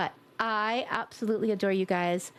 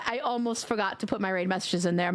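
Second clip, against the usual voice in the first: ordinary talk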